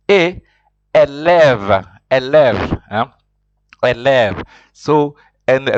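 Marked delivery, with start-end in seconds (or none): 0.9-4.4 "élève" said firmly, falling, both e's closed then open; French model word. Shown as a demonstration. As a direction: drill "élève" for the class. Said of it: "teacher models word".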